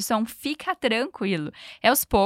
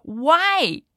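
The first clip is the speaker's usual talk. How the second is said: shocked; 5 semitones higher, 4 dB louder than usual. Acted. Noah shocked by breakup.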